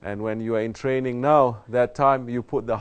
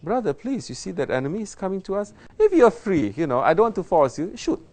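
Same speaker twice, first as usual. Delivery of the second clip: higher, fast, timid pleading; mock overpolite soldier; 8 semitones higher than usual. Soldier begging comrade to shoot, mock timid. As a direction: plead with a comrade absurdly politely.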